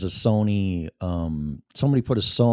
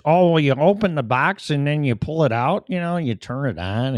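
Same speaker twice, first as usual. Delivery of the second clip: higher-pitched mock reviewer patter; mock explainer voice; mocking unboxing reviewer; 6 semitones higher than usual. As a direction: imitate a tedious video reviewer, pitched up.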